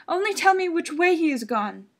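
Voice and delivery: high, loud, frantic pleading; desperate mother. Acted. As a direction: beg him back with rising panic. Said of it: "said the mother".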